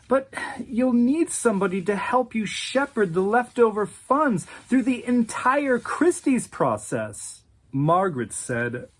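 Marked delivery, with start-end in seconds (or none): 0.1-7.4 higher, pleading Patrick voice; beg, voice pitched up; a man clutching at his last client; desperate plea. Acted.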